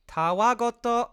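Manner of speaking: robotic text-to-speech read; flat, slow translator voice; monotone voice. Shown as a demonstration. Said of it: "it says in a monotone voice".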